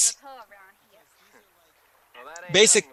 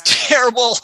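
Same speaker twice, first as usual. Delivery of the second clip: higher-pitched mock cry; mock outburst; 7 semitones higher, 4 dB louder than usual. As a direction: blurt it out in imitation of a character. Acting out the film's reply.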